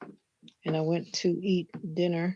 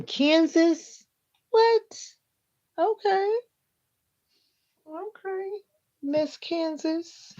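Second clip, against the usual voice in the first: much higher, louder, slow disbelieving squeal; shocked surprise; 12 semitones higher, 6 dB louder, much slower than usual. Real, not acted. Surprised reaction to viewer's state.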